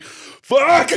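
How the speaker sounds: loud, high-pitched yelp of dismay; retold panicked curse. Marine finds his cord is backwards, shouted curse. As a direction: yell it as the blunder sinks in.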